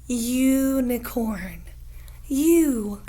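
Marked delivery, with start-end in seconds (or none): 0.1-1.6 "Unicorn" drawn out very slowly; very slow model. Shown as a demonstration. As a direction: drag each syllable of "Unicorn". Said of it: "What sound do you hear".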